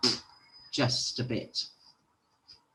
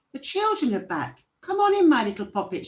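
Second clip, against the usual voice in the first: high, eager welcoming call; delighted cry; 10 semitones higher, 4 dB louder, faster than usual. A narrator's reading. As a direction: call out brightly and quickly to welcome children in. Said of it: "she cried".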